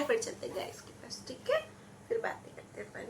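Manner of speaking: whisper. Real, not acted. Hushing toddler to eat. (बच्चे को फुसफुसाकर मनाना)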